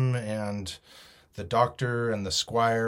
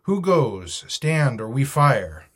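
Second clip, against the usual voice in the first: louder, higher sentry challenge; hailing; 3 semitones higher, 7 dB louder, slower than usual. A narrator's reading. Captain challenges the buccaneer outside the stockade, hailing. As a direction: call out a warning to an intruder. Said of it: "Then he hailed the buccaneer".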